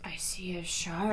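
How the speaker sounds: hushed, whispery mock announcement; Tommy. Imitating a man in a boat spotting something, whispered. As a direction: breathe it out in a low whisper. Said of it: "Tommy goes".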